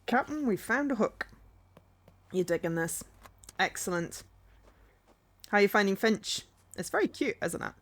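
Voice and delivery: playful put-on Scottish accent; chatty, loosely Scottish accent. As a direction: slip into a Scottish lilt. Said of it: "Apparently I'm adopting a Scottish accent".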